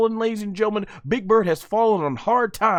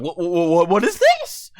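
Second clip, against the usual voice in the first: retold disbelief; 4 semitones lower than usual. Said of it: "I'm like".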